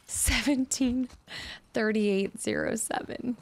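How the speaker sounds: Seventeen thirty-eight zero seven spelled out slowly, each number separate; slow deliberate readout. Shown as a demonstration. Gamer slowly reading the safe code aloud. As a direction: read the code back slowly, digit group by group. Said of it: "slowed repeat of the code".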